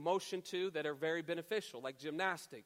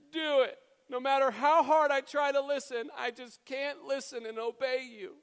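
whining crying daughter; 6 semitones higher, 5 dB louder than usual. Retold or imitated. Upset daughter telling Daddy she cannot listen, mock tearful whine. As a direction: imitate an upset child pleading helplessly. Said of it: "sobbing kind of under her pillow".

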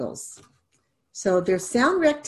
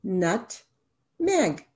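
slow, split into nut and meg; syllables tapped out. Shown as a demonstration. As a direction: tap out each syllable of Nutmeg as you say it. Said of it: "How many syllables in nutmeg?".

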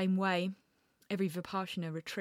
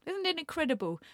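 much higher, gleeful and bright; carried away; 10 semitones higher, 5 dB louder than usual. Real, not acted. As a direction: get giddy with the word. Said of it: "I'm getting carried away".

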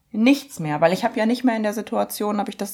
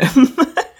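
burst of laughter; 6 semitones higher, 8 dB louder than usual. Real, not acted. Laughs at own story.

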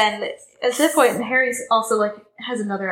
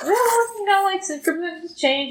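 high-pitched, lightly mocking evasive official; hedging official; 10 semitones higher, slower than usual. Fudge excusing the change to Harry, mock hedging. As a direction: imitate someone awkwardly backing out, pitched well up.

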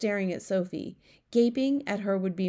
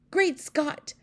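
high exclamation from the queen; astonished cry; 9 semitones higher, 4 dB louder than usual. A narrator's reading. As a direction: cry out in shock, voice raised in pitch. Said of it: "cried the famous voice".

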